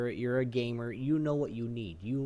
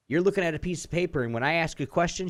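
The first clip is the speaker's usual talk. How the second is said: louder, fast heated complaint; heated rant; 4 semitones higher, 5 dB louder, faster than usual. Retold or imitated. Venting about the rep reading a script, louder. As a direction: speed up and push the volume.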